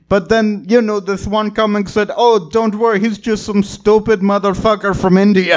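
imitating a Nepali comic's quoted dialogue; thick Nepali accent. Nepali comic quotes another comic dismissing him, accented. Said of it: "this really thick accent".

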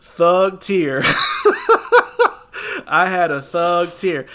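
laughing through the words. Real, not acted. Laughing while telling his friend about crying. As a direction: chuckle it out, amused at himself.

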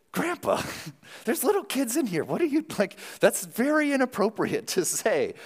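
high-pitched, scandalized objection with a laugh; shocked protest. Acted. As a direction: play an appalled grandchild telling Grandpa off. Acting out shocked reaction to grandpa.

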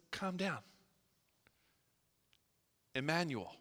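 Jesus softly comforting his worried apostles; tender reassurance. Acted. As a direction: speak it quietly, like comforting a friend.

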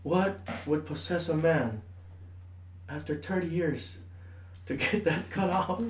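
slow, hushed, grave wondering; mock solemn question. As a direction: ponder it slowly like a sage. Quoting Jose, mock solemn.

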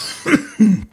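coughing after swallowing wrong; sudden coughing fit from choking. Real, not acted. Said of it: "Calma que eu engasguei aqui".

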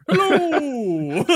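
high-pitched cartoon greeting; children's character. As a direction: squeal it like a kids' show puppet. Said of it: "like you're a children's character".

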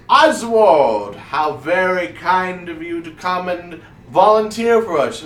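high, swooping stage voice; effusive wizard showman. Acted. Wizard thanking the gnome before the audience, effusively. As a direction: gush theatrically, starting loud.

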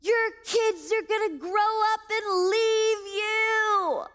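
high, loud, drawn-out holler; long-stretched retold shout; shouting across water. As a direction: call it out slowly to people far off. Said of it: "They started to shout".

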